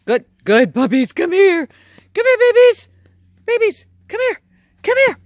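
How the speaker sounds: high, loud sing-song calling of the puppies; excited calling. Real, not acted. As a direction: call the puppies back like babies.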